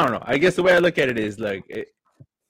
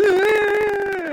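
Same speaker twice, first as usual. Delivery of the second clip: son's mock cry; 4 dB louder than usual. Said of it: "He goes".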